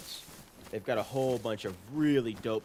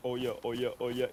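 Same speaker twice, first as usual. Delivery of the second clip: ordinary delivery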